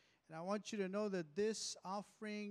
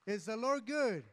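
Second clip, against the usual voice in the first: calling out loud to the congregation; louder call-out; 3 semitones higher, 8 dB louder than usual. Real, not acted. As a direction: push the crowd for a louder answer. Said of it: "Come on, I gotta hear it loud".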